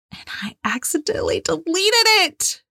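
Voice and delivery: hushed, fast whisper of regret; whispered confession. Real, not acted. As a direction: drop to a whisper as if ashamed. Confessing a painful mistake.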